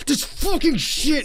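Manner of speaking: high, slow drawn-out angry snarl; furious at toys underfoot at night; furious outburst. Retold or imitated. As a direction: spit it out in blind frustration. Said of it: "I'll just be like".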